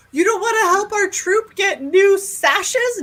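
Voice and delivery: put-on high, slow pleading kid voice; pleading scout. Scout kid pleading with a shopper, high slow. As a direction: plead like a disappointed little scout.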